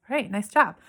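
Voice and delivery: usual speaking voice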